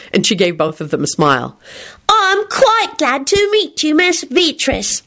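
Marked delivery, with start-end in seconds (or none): 2.1-5.0 high, slow, pompous announcement; pompous child. A narrator's reading. Teddy greets his father's guest, pompously. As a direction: stretch each word out with importance. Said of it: "Teddy announced pompously".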